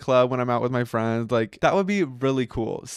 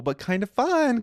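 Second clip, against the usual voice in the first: squeaky high; 12 semitones higher than usual. Real, not acted. Corsets painful but fun.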